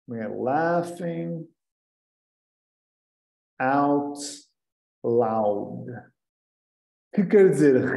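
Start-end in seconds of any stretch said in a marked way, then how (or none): 0.3-5.8 drawn out with pauses; teacher explaining; slow spelled-out meaning. Shown as a demonstration. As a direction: unpack the acronym slowly. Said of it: "significa laughing".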